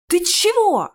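high-pitched, startled protest; indignant outburst. Acted. Narrator rounds on Ches who broke his spell, indignant. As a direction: burst out at him, pitch jumping. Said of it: "не удержался я".

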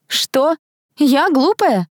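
high-pitched offended disbelief; incredulous. A narrator's reading. Girl reacts to being called stupid, incredulous. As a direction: echo it back high, unable to believe it. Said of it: "не поверила она".

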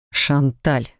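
low, restrained growl of a name; near growl. A narrator's reading. Husband interrupts his chattering wife, nearly growling. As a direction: cut in low, mildly exasperated. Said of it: "Едва не рыча прервал меня".

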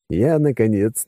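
plain reading voice

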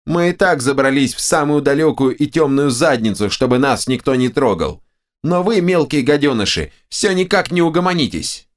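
high, angry ogre-head rant; the ogre's right head rants at the hero; squealing ogre head. A narrator's reading. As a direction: berate the intruders in a squealing voice. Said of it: "визгливый голос".

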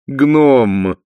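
loud, high, drawn-out correction; gushing duchess. A narrator's reading. Sibyl taking her husband's correction, gushing duchess voice. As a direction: sing out the word grandly.

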